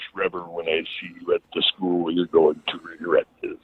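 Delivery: soft, low veteran's threat; Harley Race impression. Harley catches on and warns his student, Harley Race impression. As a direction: quietly promise payback as the wrestling boss. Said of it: "he caught on".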